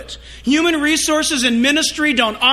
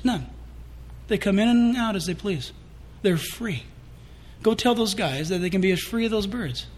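softer, calm reassurance; gentle voice of God; 3 semitones lower, 7 dB quieter than usual. Retold or imitated. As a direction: speak as God comforting him. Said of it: "And the Lord says".

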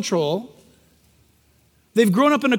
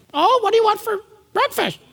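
high, squawky parrot-like "what do you want"; mock parrot parent; 10 semitones higher than usual. Preacher mocking a parroting parent, high squawky voice. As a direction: ham up a parent parroting the kid, much higher.

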